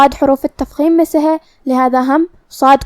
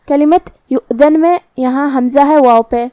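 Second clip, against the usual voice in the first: normal talking voice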